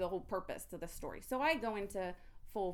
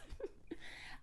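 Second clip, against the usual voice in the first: soft laugh; 9 dB quieter than usual. Real, not acted. Laugh after venting.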